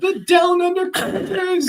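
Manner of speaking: high, drawn-out Australian announcer call; hammed-up Aussie accent, loud and very high; playful Australian show welcome; put-on Australian accent. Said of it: "You're from Australia".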